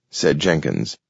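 ordinary delivery

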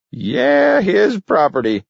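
put-on very high shrill old man. A narrator's reading. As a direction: shriek it gleefully. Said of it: "shrilled Gramp".

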